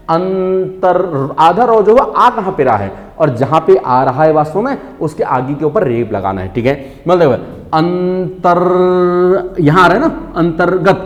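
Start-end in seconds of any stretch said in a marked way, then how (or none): 0.1-1.3 अंतर- dragged out very slowly, syllable by syllable; stretched pronunciation demo. Shown as a demonstration. Teacher shows the correct pronunciation, very slow. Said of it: "उच्चारण करना पड़ेगा ठीक तरह से". 7.7-9.6 अंतर- dragged out very slowly, syllable by syllable; stretched pronunciation demo. Shown as a demonstration. Teacher shows the correct pronunciation, very slow. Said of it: "उच्चारण करना पड़ेगा ठीक तरह से".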